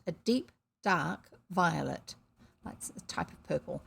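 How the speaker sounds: usual speaking voice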